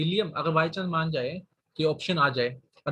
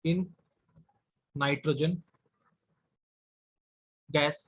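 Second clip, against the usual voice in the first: dictating with long gaps between words; slow dictation; much slower than usual. Shown as a demonstration. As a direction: give each word time to be written. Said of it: "dictating notes with long pauses". (रुक-रुककर लिखवाते हुए)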